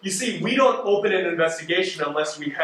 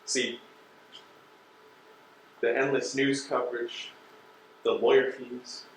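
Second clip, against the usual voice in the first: low menace; 7 semitones lower, 7 dB quieter than usual. Acted. Investigator lists consequences menacingly.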